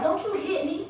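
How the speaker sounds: high-pitched furious warning, retold; retold angry yell. To boyfriend who punched her, a high furious warning. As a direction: snap back at him high and sharp.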